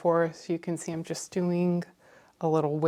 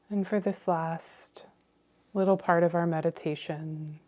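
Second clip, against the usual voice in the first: guided meditation. Acted. Meditation leader inviting listeners to fill their cup, calm and unhurried. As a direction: guide them gently, phrase by phrase, leaving space.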